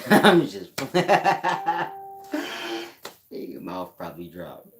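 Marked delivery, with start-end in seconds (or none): 0.1-3.2 high, loud burst of laughter; cackling at his own joke; loud cackle. Real, not acted. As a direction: crack up laughing hard.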